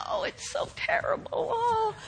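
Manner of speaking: whispered high, pitiful moan; mock whining. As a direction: ham up the self-pity. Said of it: "everybody's calling them".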